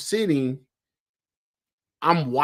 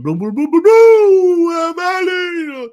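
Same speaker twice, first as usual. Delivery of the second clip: imitated noisy announcer; 12 dB louder than usual. Fan mimicking Valley's noisy announcer, booming hype shout. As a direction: mimic an over-the-top stadium announcer at full blast. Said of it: "they got that announcer who does a lot of".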